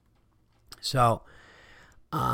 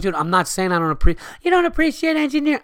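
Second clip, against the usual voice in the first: put-on heated drunk retort; 11 semitones higher, faster than usual. Drunk argument, quoting himself.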